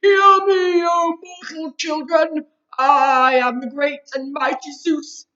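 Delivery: put-on Zeus booming at the mortal children; window-rattling voice. A narrator's reading. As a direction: thunder it across the playground like a god. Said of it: "said in that window-rattling voice".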